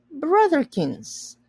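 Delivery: high, slow, drawn-out pet name; fond call. A narrator's reading. Charles fondly calling his tiny brother to dinner, high and slow.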